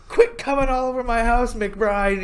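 high, indignant movie-quote voice; imitated quarrel, high and hammed-up; mock scolding.

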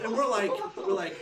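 loud laugh spilling over the words. Real, not acted. Laughing while retelling grandpa's strange joke, loud and amused.

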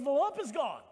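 imitated excited announcement, high and loud; all excited. Friend phoning to say the lump is gone, all excited. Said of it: "You sound all excited".

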